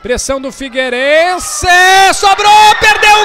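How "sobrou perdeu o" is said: surprised shout. Real, not acted. Missed chance, sudden shout.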